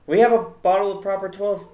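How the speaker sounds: mock Irish voice. Imitating Conor in the bar.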